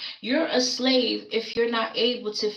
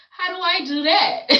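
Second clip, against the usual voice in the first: exasperated; 9 semitones higher, 6 dB louder than usual. Retold or imitated. Frustrated inner monologue.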